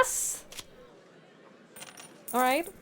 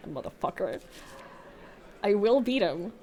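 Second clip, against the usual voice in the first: ordinary delivery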